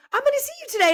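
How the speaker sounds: high, thrilled voice of the nail tech; excited high imitation. Nail tech thrilled to hear a regular is coming: excited high imitation. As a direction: squeal it like a delighted old friend.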